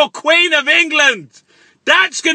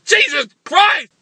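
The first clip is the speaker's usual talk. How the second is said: normal talking voice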